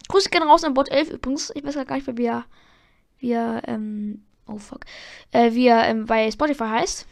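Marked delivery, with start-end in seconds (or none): none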